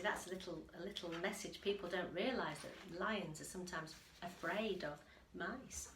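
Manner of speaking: ordinary delivery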